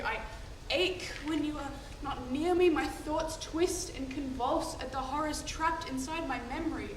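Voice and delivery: soft, intimate declaration of love; tender confession. Acted. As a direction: confide gently to a lover, softening toward the end. Confesses love to her beloved.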